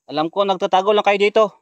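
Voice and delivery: loud, high call into the woods. Real, not acted. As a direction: shout it out to whoever's hiding. Calling out to hidden creatures.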